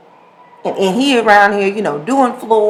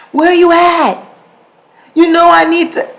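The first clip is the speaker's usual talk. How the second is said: a raised, higher, louder demand down the phone; impatient husband on phone; 9 semitones higher, 5 dB louder than usual. Retold or imitated. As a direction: press him to hurry, voice up high.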